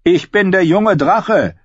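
high, loud, drawn-out boast; boastful call. A narrator's reading. Robb claiming a hero's name in play, calling out. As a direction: call it out proudly as a boy playing hero. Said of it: "Oder Robb sagte".

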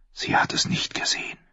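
a soft inner whisper; whispered thought. Acted. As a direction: breathe it as a secret realisation. Davos's silent thought.

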